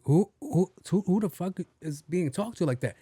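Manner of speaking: high, disbelieving; can't believe what he hears; incredulous. Retold or imitated. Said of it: "I'm like".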